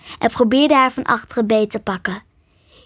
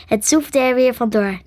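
usual speaking voice

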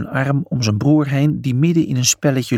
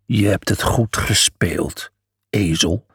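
low, slow grudging lion; 4 semitones lower, slower than usual. A narrator's reading. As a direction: admit it reluctantly, deep. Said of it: "gaf Miles toe".

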